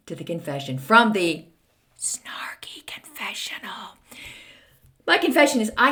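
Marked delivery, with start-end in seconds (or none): 2.0-4.0 hushed, unvoiced whisper. Real, not acted. Host introducing her confession segment in a whisper. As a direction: breathe the segment name like a secret.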